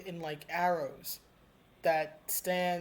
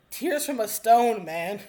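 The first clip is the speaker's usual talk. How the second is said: louder, higher, moved almost to tears; emotional swell; 4 semitones higher, 10 dB louder than usual. Real, not acted. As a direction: let the emotion burst through.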